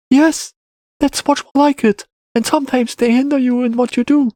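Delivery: put-on high-pitched boy's reply; agreeing child. A narrator's reading. Boy agrees about scars, high child voice.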